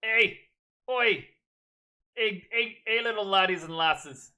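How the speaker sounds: loud, high mock Scottish accent; hammed-up Scottish accent greeting the class; Scottish-ish accent. Shown as a demonstration. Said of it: "Talk with an accent".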